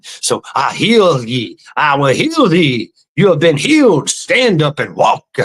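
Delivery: hammed-up faith healer. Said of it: "He goes".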